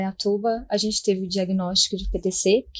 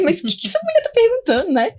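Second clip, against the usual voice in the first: much higher, fast, stunned inner voice; shocked disbelief; 14 semitones higher, 4 dB louder, faster than usual. Retold or imitated. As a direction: blurt it out in disbelief. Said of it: "aí que eu fiquei em choque mesmo". (espanto incrédulo, agudo e rápido)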